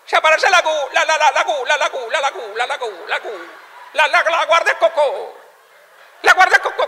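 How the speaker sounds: high-pitched machine-gun stammer; stutterer. Acted. Raft owner explaining his plan, high stuttering. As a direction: hammer the syllables over and over, pitched high.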